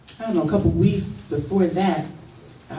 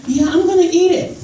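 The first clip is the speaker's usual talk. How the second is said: put-on high eager kid voice; 12 semitones higher, 7 dB louder than usual. Kid insisting.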